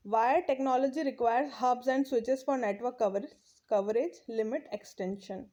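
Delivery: reading the English text aloud, very slowly; slow textbook reading. Acted. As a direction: read each phrase out deliberately for the class. Reads textbook line slowly. (धीमी गति से पाठ पढ़ना)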